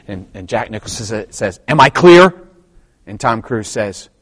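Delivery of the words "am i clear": raised, louder movie-quote challenge; firm demand. Retold or imitated. Officer on trial questions the lawyer, firm imitated demand. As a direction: put the question firmly, imitating the film. First demand in courtroom scene.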